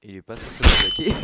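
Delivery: loud indignant cry at the screen; outraged surprise. Real, not acted. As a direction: yell it as the enemy hits you. Enemy attacks unexpectedly in game.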